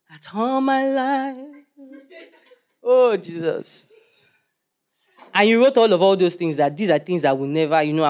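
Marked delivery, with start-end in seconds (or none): 0.1-3.9 mock devotion. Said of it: "you wrote it down".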